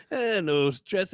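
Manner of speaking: put-on drawn-out French Canadian accent. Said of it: "sounds like you're stressing yourself out".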